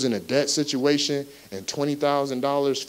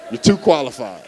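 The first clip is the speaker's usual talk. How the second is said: mocking boss; 4 dB louder than usual. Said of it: "they hit you with something like".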